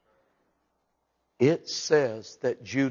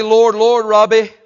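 pleading shout; 7 semitones higher, 14 dB louder, slower than usual. Acted. Preacher voicing pleading at judgment.

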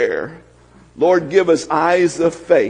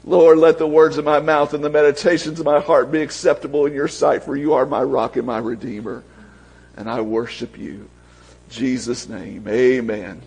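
prayer; 5 semitones lower, faster than usual. Acted. Pastor prays aloud.

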